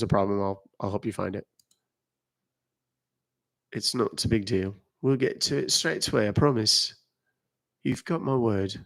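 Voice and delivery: lightly put-on Irish lilt; Irish-sounding accent. Shown as a demonstration. Streamer reassures a viewer with a promise, Irish-sounding accent. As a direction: say it with a hint of Irish. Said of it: "What sounded more Irish".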